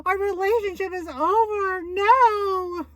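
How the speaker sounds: hammed-up high sobbing wail; mock crying wail. Retelling how she overreacted to a friend's breakup, mock crying wail. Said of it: "I was like".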